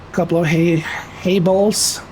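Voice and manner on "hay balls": ordinary delivery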